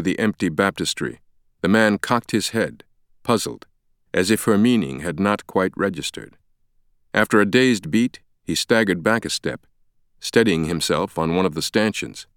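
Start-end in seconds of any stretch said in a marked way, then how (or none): none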